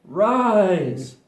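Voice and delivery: loud, pleading command to rise. Shown as a demonstration. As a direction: call it up like a spell.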